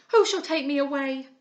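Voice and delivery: angry defiance. A narrator's reading. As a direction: challenge them all to try. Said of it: "cried she angrily".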